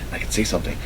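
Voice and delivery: very soft, half-whispered musing; retold murmur. Imitating boss planning his speech, hushed musing. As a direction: think aloud under your breath. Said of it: "He's like".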